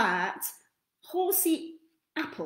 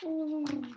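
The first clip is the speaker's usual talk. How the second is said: soft laugh; 5 semitones higher, 5 dB quieter than usual. Real, not acted. Narrator laughs.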